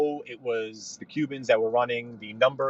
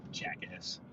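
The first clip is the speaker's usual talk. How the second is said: breathy whispered jackass, much softer; whispered insult; 9 semitones lower, 16 dB quieter than usual. Real, not acted. Driver insults the other car in a whisper. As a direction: hiss it under your breath.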